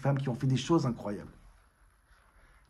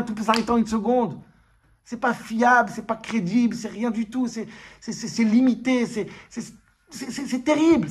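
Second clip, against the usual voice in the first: higher, louder, driving sermon voice; impassioned preaching; 9 semitones higher, 7 dB louder than usual. Acted. Preacher urging the congregation, louder and higher. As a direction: hammer the point home to the room.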